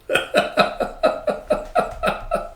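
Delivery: long staccato chuckle; even, rapid bursts of laughter. Real, not acted. Laughs after reading the article.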